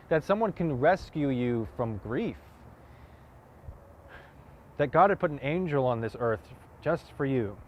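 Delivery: impassioned challenge, punching key phrases; forceful monologue. Acted. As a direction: push each point home with rising pitch and force. Same monologue, second take pushed harder.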